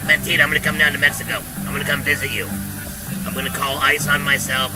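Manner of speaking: ordinary talk, nothing marked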